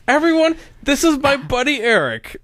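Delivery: high-pitched imitation of an eager announcement; excited introduction, imitated. Imitating Cindy introducing a friend to everyone, excited. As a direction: announce him to the room, voice way up. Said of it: "that was the introduction".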